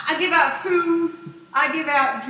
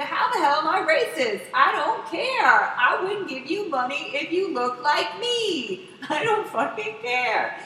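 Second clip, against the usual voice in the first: higher, slow, exasperated retold protest; acted indignant denial; 4 semitones higher, slower than usual. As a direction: protest the accusation, drawing out the words. Acted quarrel, denying the accusation.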